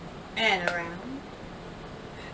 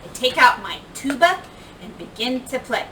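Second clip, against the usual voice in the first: ordinary delivery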